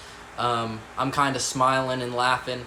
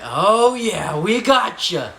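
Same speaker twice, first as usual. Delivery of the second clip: gloating adults; 6 semitones higher, 7 dB louder, much slower than usual. Retold or imitated. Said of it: "they're like".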